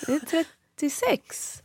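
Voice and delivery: slow, soft, puzzled woman's answer; a lightly voiced stranger, bewildered and slow. Woman at the door answers the wrong visitor, slowly and softly. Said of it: "Hon bara".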